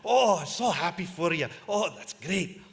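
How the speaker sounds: high, loud, gushing congratulations; over-the-top delighted, high voice; gushing praise. Retold or imitated. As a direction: pump it up like a thrilled old boss. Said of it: "He's going".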